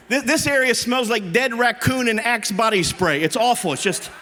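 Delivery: ordinary talk, nothing marked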